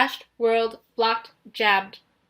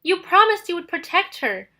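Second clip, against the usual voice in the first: high, louder hurt accusation; accusing; 7 semitones higher, 4 dB louder than usual. Acted. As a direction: throw the broken promise at him. Nico accuses Percy, upset.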